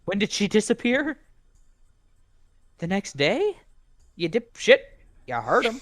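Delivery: loud, high angry yelling; angry shouting. Acted. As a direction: yell it in furious disbelief. Angry disbelief at game character.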